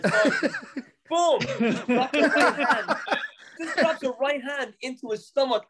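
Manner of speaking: cracking up telling the punch; laughing excitedly. Real, not acted.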